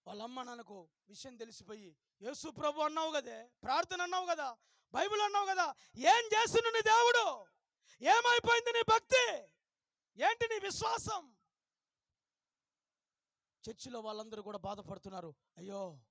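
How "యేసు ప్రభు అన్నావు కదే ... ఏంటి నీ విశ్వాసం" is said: angry scolding parents. Retold or imitated. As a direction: scold her as a mocking parent. Parents scold daughter after failing. (తల్లిదండ్రుల కోపంతో గట్టిగా తిట్టడం)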